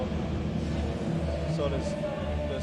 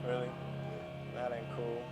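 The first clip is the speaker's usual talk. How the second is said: usual speaking voice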